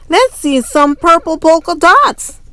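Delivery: put-on high, loud kid's taunt; kid yelling. A classmate yells at Camila, high and loud. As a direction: call it out across the classroom. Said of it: "One yelled out".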